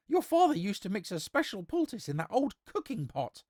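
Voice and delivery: high-pitched peasant woman pleading. Acted. Peasant woman continues her plea.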